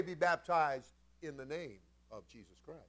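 ordinary talk, nothing marked

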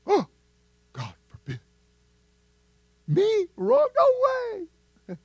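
squeaky high outraged "Me? Wrong?"; mock indignant denial. Preacher mimics a proud churchgoer, squeaky mock indignation. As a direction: play the proud one, shocked at the idea.

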